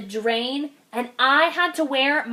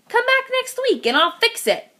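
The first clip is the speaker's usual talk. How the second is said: much higher, lightly voiced cheerful dentist; 10 semitones higher than usual. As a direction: chirp it like a breezy dentist.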